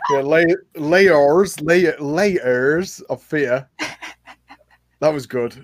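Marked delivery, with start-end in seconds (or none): 0.2-3.7 "layers" broken into two stretched syllables, lay-ers; exaggerated enunciation. Shown as a demonstration. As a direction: playfully overdo "layers of fear", very slowly. Exaggerates after being corrected.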